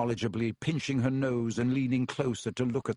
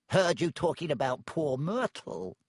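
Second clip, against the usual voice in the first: sly poltergeist voice; 3 semitones higher than usual. Acted. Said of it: "said Peeves".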